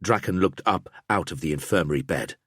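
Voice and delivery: usual speaking voice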